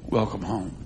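low, soft and tender; gently receive someone home in a hushed, low voice; tender welcome. Acted. God welcomes the repentant sinner home, tender and soft.

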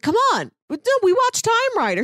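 high, pleading insistence; insistent girl. Retold or imitated. Girl urging her friend to remember the show, high and insistent. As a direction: come on, you remember, pushed up high. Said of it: "She's like".

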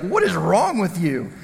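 slow, incredulous demand; appalled disbelief. Retold or imitated. Friend who just dodged a flying bat to the thrower, incredulous. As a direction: draw it out as an imitated outraged friend.

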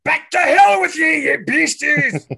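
high, drawn-out shout at the undead; big hammed-up banishing yell; battle cry. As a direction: roar it to drive the monsters off. Player calls out his cleric's turning cry.

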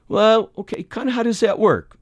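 mock-innocent know-nothing question; playing dumb. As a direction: play the clueless dunce who wants to learn. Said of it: "you play Tommy the Dunce".

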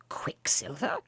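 unvoiced, wondering echo; whispered puzzlement. A narrator's reading. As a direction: breathe the name in disbelief. Philemon repeats the odd name.

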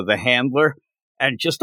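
usual speaking voice

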